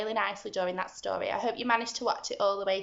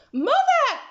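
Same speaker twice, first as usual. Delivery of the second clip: much higher, louder urgent call; urgent shout; 4 dB louder than usual. Acted. Jack calls his mother for the axe, shouting. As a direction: shout for help across the yard. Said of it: "he shouted".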